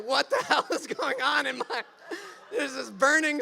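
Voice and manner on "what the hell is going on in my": high, loud, laughing outburst; laughing disbelief. Real, not acted. Storyteller reliving his first burn of liquor, laughing. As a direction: exclaim through your own laughter.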